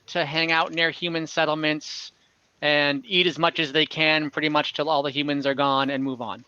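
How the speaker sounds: ordinary delivery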